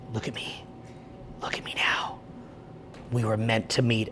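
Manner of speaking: hushed, deep, intense whisper; intense low whisper. Retold or imitated. Hypnotising a stranger on the street, low intense whisper. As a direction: lock eyes and murmur like a mystic. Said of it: "I'll grab them by the head and I'll be like".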